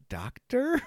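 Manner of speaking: high, disbelieving "Doctor?" through a laugh; incredulous laughing question. Retold or imitated. Buckaroo's incredulous reply.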